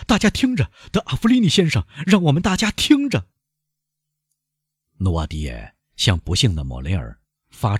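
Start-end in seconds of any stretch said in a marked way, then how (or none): 0.1-3.3 high, loud call for attention; loud young man. A narrator's reading. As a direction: raise the voice high to the whole room. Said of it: "年轻人大声地说". (年轻人高声大喊)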